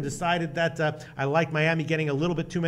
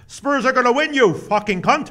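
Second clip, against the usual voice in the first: loud, high mock-British cheer; boisterous fake-British shout, raised in pitch; faux British accent; 8 semitones higher, 7 dB louder than usual. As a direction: belt it out in a terrible British accent. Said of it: "He's like".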